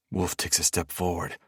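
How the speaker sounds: plain reading voice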